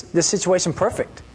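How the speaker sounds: ordinary talk, nothing marked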